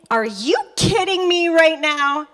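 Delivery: slow, high mock fury; hammed-up outraged question; mad, disbelieving. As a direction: stretch it out in exaggerated disbelief. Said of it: "'cause I'm mad".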